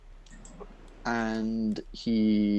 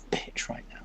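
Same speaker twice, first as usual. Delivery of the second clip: whispered curse; 6 dB quieter than usual. Real, not acted. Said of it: "my computer could not be".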